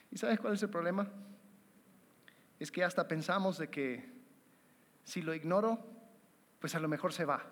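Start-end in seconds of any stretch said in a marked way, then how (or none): none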